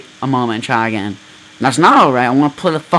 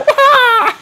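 mock ninja cry; 4 dB louder than usual. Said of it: "I was like".

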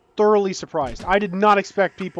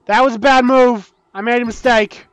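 loud, high cry of dismay; alarmed regret; 6 semitones higher, 7 dB louder than usual. Real, not acted. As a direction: blurt out the blunder in alarm. Blunder in game.

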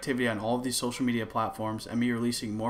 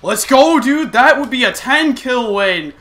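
excited shouting; 12 semitones higher, 16 dB louder, slower than usual. Real, not acted. Celebrating a game win.

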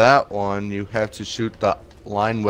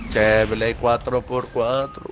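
put-on southern accent; slower than usual. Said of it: "talking in a southern accent".

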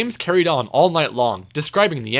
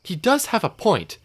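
higher-pitched mild engine voice; Edward, agreeing; 6 semitones higher than usual. A narrator's reading. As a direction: chip in lightly, pitched up. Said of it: "Edward added".

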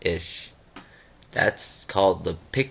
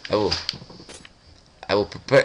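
ordinary talk, nothing marked

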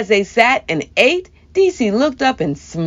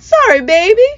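sweetly; 11 semitones higher, 6 dB louder than usual. A narrator's reading. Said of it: "answered Meemaw sweetly".

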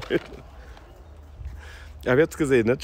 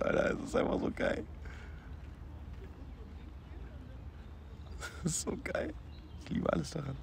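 hushed; 9 semitones higher, 12 dB quieter than usual. Real, not acted. Hushed while watching the old men.

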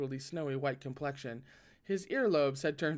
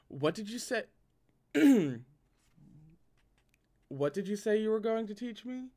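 dark question; 5 semitones higher than usual. A narrator's reading. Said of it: "he asked darkly".

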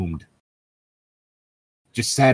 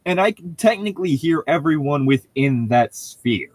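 raised, half-laughing explanation; excited shout; 6 semitones higher than usual. Acted. Laughing D and D analogy.

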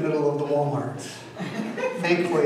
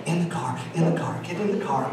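soft, fast muttering to oneself; telling himself to get in the car, soft and fast; urgent mutter to self; 7 dB quieter, much faster than usual. Retold or imitated. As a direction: hurry yourself under your breath. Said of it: "my chance to go".

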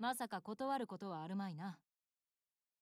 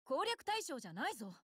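indignant, higher-pitched rebuttal; flustered protest; 4 semitones higher than usual. Acted. Warrior rebuffing the commander's advances, flustered. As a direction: object in a fluster, voice jumping up.

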